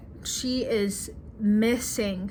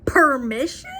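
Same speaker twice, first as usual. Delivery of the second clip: incredulous repeat; 4 semitones higher, 5 dB louder than usual. Retold or imitated. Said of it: "He's like".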